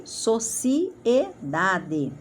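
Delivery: sociedade drawn out syllable by syllable; slow, syllabled sociedade; slowly spelled out. Shown as a demonstration. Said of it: "a primeira, então".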